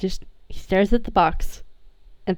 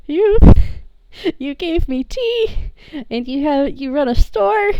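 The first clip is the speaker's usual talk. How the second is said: put-on higher, halting voice of a wide-eyed kid; amazed kid; 6 semitones higher, 4 dB louder than usual. Player voices Sammy's amazement at Luca, high and halting. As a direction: voice the kid piecing it together, pitched up. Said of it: "Sammy's just like".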